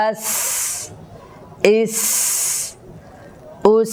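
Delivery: short level vowel, long hissed s ending আস, ইস, উস; breath after consonant. Shown as a demonstration. Teacher closing the seen drill, long hiss after আস, ইস, উস. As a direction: release a sustained sharp s after each vowel of আস, ইস, উস. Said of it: "হামসের আওয়াজটা সাকিন উচ্চারণ করার পরে হবে".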